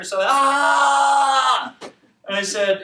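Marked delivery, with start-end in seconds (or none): 0.2-1.7 loud, high mock scream; sudden hammed-up scream; imitated man's scream. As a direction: yell out as if overwhelmed. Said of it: "He starts screaming".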